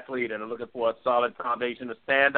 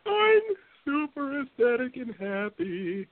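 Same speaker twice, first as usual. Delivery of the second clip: gushing excited. Acted. Gushing happy reply.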